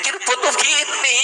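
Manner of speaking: high, insistent correction; local correcting. Retold or imitated. Real Cirebon man correcting the pretender, high and insistent. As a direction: set the pretender straight.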